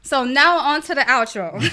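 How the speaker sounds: giggling through the words; laughing. Real, not acted. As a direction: laugh as you speak. Laughing after emotional thank you.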